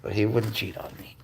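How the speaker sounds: ordinary delivery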